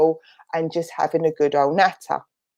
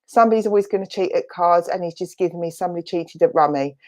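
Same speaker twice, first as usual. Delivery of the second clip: usual speaking voice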